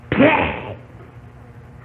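slow, drawn-out breathy smack noise; smack sound. Retold or imitated. Boy imitating the sound of his mother's blows, drawn-out breathy smack. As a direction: stretch the hit into a long airy ピエー.

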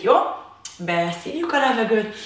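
ordinary talk, nothing marked

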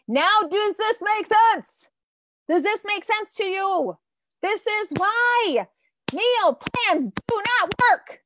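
high, loud, slow insistence; emphatic teacher. Shown as a demonstration. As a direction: hammer the point home to the class. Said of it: "emphatic teaching point".

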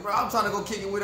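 usual speaking voice

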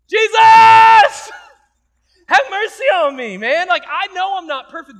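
high, loud cry for mercy; desperate shout. Acted. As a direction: scream it out to be heard over the crowd. Blind Bartimaeus crying out to Jesus.

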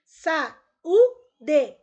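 "Saúde" split into sa, ú, de with pauses; "Saúde" broken up for dictation; syllable by syllable. Shown as a demonstration. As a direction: say "Saúde" loudly in three separate syllables. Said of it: "Separe as sílabas das palavras".

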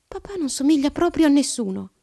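put-on high, loud child's protest; little girl declaring it loudly; emphatic exclamation. A narrator's reading. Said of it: "esclamò Alice".

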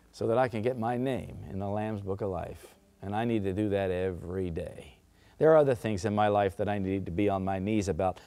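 hushed sincere. Real, not acted. Personal confession to congregation.

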